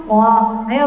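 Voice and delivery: normal talking voice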